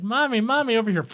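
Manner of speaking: imitated child calling for mother; excited kid. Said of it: "at least one kid who's like".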